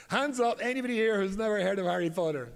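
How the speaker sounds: loud, high call to the room; rally the audience with a hands-up call. Retold or imitated. Rabbi asks Russian audience about Harry Potter, loud and high.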